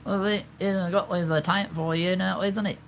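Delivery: high-pitched British character voice; hammed-up high British chatter; put-on British accent. Shown as a demonstration. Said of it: "when I do the British and Scottish voices".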